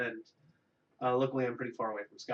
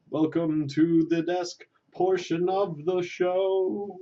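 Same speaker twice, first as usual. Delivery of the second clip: higher, louder and slower; grand host welcome; 5 semitones higher, 6 dB louder, slower than usual. Shown as a demonstration. Host re-welcomes viewers to the desk portion, grand and loud. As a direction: draw it out like a big TV host. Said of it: "host re-does the welcome".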